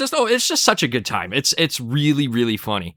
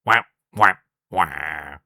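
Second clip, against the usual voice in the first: sad trombone; 10 semitones lower, 4 dB quieter, slower than usual. Retold or imitated.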